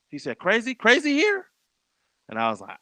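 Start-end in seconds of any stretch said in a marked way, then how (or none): 0.4-1.5 much higher, rising disbelief; imitated surprised question. Lazy Bone hears who invited them, imitated surprised question. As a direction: echo the name, pitch climbing in surprise.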